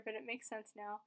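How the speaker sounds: ordinary talk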